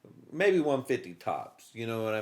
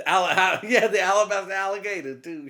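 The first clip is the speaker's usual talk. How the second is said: laughing through the name; giggling as he finally gets the name out; half laughing, voice up; 6 semitones higher, 5 dB louder than usual. Real, not acted. Laughing while listing names.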